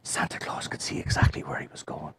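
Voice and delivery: soft breathy storytelling whisper; whispered wonder. A narrator's reading. Storyteller whispering Santa finally seeing his way. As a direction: hush it like a marvel.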